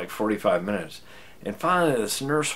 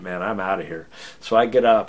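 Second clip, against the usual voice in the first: ordinary delivery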